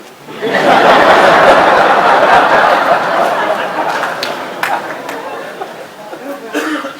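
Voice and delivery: long, loud, breathy burst of laughing; the room roaring at the joke; real hearty laughter. Real, not acted. Audience laughs after quote.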